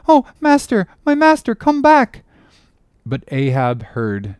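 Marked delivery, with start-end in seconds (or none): 0.1-2.2 very high, loud desperate plea; crying out. A narrator's reading. Boy at the cabin window begs his master back, high loud cry. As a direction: cry after someone leaving, pitched right up. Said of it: "cried a voice".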